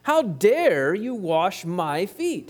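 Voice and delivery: indignant Peter. Retold or imitated. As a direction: act out Peter's outraged refusal. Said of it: "He's wanting to say".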